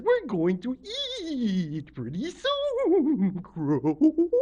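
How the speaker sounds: slow, loud, drawn-out creature voice; octopus lady. A narrator's reading. Octopus Lady promises Crow food soon, in a swooping drawl. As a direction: stretch the vowels high, then slide down. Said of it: "Octopus Lady says".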